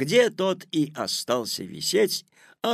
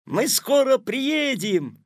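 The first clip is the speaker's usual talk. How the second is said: high, loud, drawn-out excited call; excited exclamation; 10 semitones higher, 5 dB louder, slower than usual. A narrator's reading. Petya to Tik-tak while flying through time, excited. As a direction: call it out slowly, high and eager.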